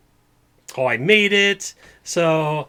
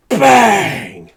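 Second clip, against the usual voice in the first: a sudden loud bang, much higher; gunshot sound; 9 semitones higher, 13 dB louder than usual. Acted. GM fires the sheriff's gun, loud bang. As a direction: shout the gunshot out.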